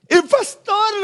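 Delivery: put-on very high child's voice; proud little girl. Pastor voicing his daughter insisting to the teacher, very high child voice. Daughter repeats her answer.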